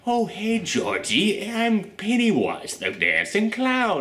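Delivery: high, slow, sweet clown voice; sweet innocent Pennywise. Retold or imitated. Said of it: "going back and forth between the".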